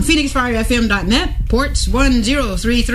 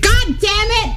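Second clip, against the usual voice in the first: put-on toddler voice; 11 semitones higher, slower than usual. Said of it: "and she said".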